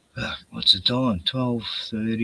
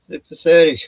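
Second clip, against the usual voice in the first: scornful; 5 semitones higher, 8 dB louder than usual. Real, not acted. Mocking a show pony's prison stories.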